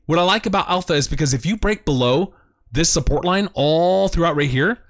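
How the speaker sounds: ordinary talk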